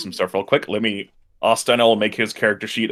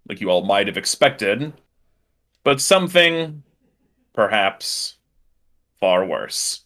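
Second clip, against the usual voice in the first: building dramatic scene setting; dramatic narration, slow on the big reveal; game master narration; slower than usual. A narrator's reading.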